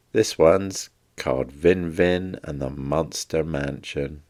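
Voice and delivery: plain reading voice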